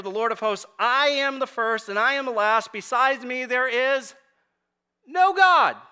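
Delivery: loud, high proclamation of the verses; fiery scripture reading. Real, not acted. Preacher proclaiming Isaiah to the congregation, loud and high. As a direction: thunder out God's words.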